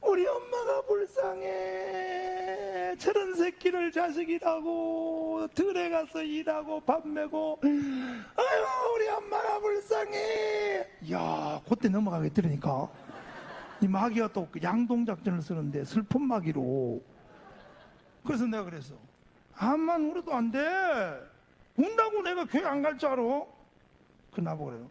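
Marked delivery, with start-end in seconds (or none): 0.0-10.9 high, drawn-out tearful wail; mock sobbing lament, very slow and high; imitated bawling. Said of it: "엉엉 울면서". 19.6-23.2 high-pitched acted rebuttal; mock-indignant, raised voice; defiant retort. Acted defiant retort to crying sister.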